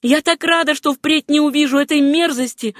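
high-pitched woman's relief; high, glad to be rid of something vile; Etta's relieved exclamation. A narrator's reading. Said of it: "сказала Этта".

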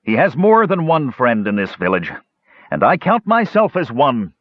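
a touch of booming declaration; a light thunder on the promise of friendship; a lightly voiced mage, raising his voice to defend a friend; mild thunder. A narrator's reading. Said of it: "the mage thundered".

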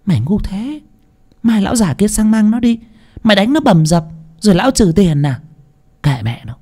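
high-pitched scolding, dropping at the end; exasperated mistress. A narrator's reading. Mistress rebuking foolish cook, exasperated scold. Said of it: "Bà Phú nghe vậy thì trợn mắt".

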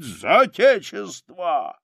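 loud, high, slow rallying shout; imitated general's war cry; battle cry. A narrator's reading. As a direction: cry it out to the troops, drawn out. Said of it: "кричит".

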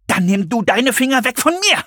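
high, strained, gasping protest; breathless, high-pitched angry retort; panting. A narrator's reading. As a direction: pant it out while struggling free. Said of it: "keuchte Serenity".

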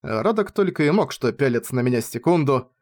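plain reading voice

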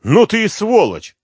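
high, loud angry cry; furious outburst. Acted. Insulted woman lashes out at the detective, furious. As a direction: spit the insult at him.

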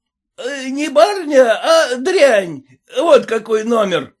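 high, loud abusive yelling; yelling peasant. A narrator's reading. Red-faced peasant yelling after the lady, high loud abuse. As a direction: shout insults at the lady. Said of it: "кричал он".

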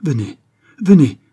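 building to a high, loud call; eager, escalating urging; urgent. A narrator's reading. Young man urging the count along, urgently. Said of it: "dit vivement le jeune homme".

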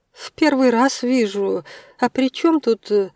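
a baffled woman, pitch lifted; puzzled surprise. A narrator's reading. Tatiana shown the statue. (растерянное недоумение)

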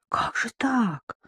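soft, high, drawn-out dismay; dismayed gasp. A narrator's reading. Mother hearing there may be no wedding, gasping in dismay. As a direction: gasp it quietly, aghast. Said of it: "ахнула Александра Ивановна".

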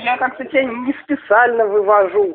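ordinary talk, nothing marked

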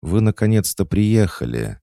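high, loud happy exclaim; overjoyed. Acted. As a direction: welcome her with joy. Alisa overjoyed greeting. (радостно, восклицая)

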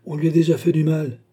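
plus aigu et plus fort, plaider pour l'enfant; protestation soucieuse. A narrator's reading. Vieux gentleman plaide pour l'enfant. (protestation inquiète et appuyée)

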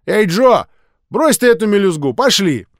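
put-on high, loud boy's call; impatient kid summoning his pal. A narrator's reading. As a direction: holler to a friend to come along. Boy calls friend away.

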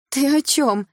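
high, loud flustered denial; flustered muttering. A narrator's reading. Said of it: "бормотала Милана".

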